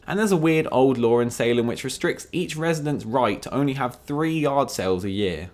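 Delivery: ordinary delivery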